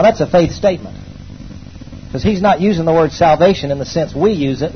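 ordinary talk

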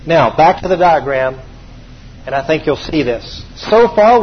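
usual speaking voice

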